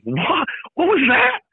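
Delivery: high, astonished double take; stunned fan. Retold or imitated. Guest imitating a stunned fan in the crowd. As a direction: act floored, pitch leaping up. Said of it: "sitting in the crowd this year going".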